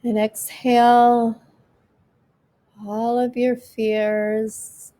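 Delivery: slow, calm breathing guide; guided meditation. Acted. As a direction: ease them through each breath.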